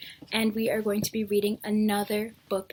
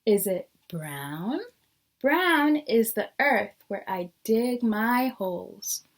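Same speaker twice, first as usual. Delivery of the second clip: lively storybook reading with rising questions; teacher reading a picture book to the class; reading to children; slower than usual. Acted. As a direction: read each guess like a curious cat. Reads the picture book aloud.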